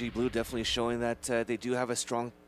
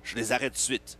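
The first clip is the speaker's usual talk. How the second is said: joking French. Pretend. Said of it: "Sorry, I'm French".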